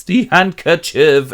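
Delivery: slow, loud convulsive cry; hammed-up fit, dragging out the words; having fits. Jealous husband has fits over the handkerchief, mock convulsive. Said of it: "he's having epileptic fits".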